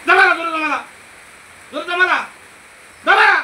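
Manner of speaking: furious exorcist shout; commanding shout. Real, not acted. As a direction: order the spirit with force.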